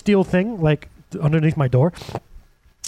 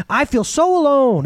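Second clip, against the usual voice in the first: mock lament; 10 semitones higher, slower than usual. Voicing a lonely believer.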